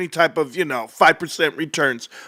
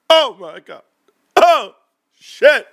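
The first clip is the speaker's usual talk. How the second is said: sudden loud high gasp of shock; 4 semitones higher, 5 dB louder, slower than usual. Real, not acted. Streamer reacts to viewers about the market drop. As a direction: blurt it out in disbelief.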